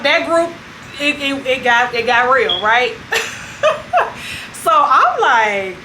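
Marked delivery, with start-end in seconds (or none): none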